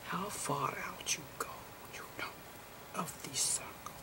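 soft unvoiced whisper, explaining gently; whispered lecture. Lecturer explaining photon slices to viewers, whispering.

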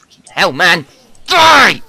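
loud high battle yell; angry shout. Real, not acted. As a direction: scream at the monster. Fighting Enderman.

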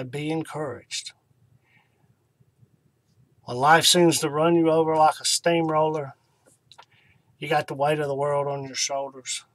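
normal talking voice

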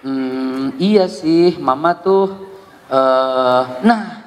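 low hesitating drawl; slow hesitant mimic. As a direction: copy the child's slow pace. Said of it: "dengan kecepatan yang sama". (meniru anak yang lambat)

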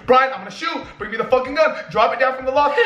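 high, urgent mock yell; retold screaming. Yelling up to Brian for the gun, mock screaming. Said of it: "And I'm screaming".

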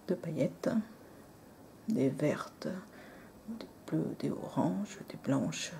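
whispered list of glitter colours. Real, not acted. Vlogger whispers the glitter colours to viewers. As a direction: whisper each colour softly.

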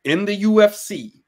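put-on failed Long Island accent. Imitating Weidman's Long Island interview, badly. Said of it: "I can't do a Long Island accent".

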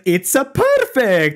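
high, slow hammed-up Italian accent; mock Italian accent. Imitating the voice actor's Italian accent for friends. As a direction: stretch it out in a cartoon Italian accent. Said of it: "And he said it in the accent too".

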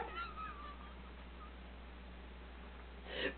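breathless soundless laugh; silent laugh. Real, not acted. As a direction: shake with quiet laughter. Laughing after parrot punchline.

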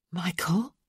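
whispered, unvoiced question. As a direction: breathe his name into the dark room. Creeping into a sickroom.

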